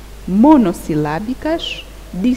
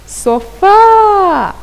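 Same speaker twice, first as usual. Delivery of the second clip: Sofá with a long, high, loud FÁ; stress on last syllable; 11 semitones higher, 7 dB louder than usual. Shown as a demonstration. Teacher showing pupils the tonic syllable, FÁ in Sofá loudly stretched. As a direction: call out Sofá, holding the final syllable high before it falls. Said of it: "A sílaba tônica recai na última".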